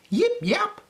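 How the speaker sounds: squeaky little puppy bark; high puppy yip. A narrator's reading. Storyteller barks as little Peppy, squeaky high yip. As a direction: yip it out high like a tiny pup. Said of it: "barked Peppy".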